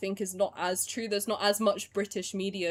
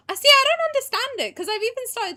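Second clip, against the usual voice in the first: exasperated squeal; 9 dB louder than usual. Real, not acted. Frustrated with Discord notifications.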